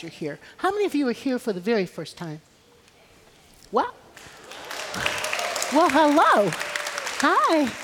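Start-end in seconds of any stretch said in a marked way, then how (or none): 3.7-7.7 excited greeting. Real, not acted. Greeting first-time visitors.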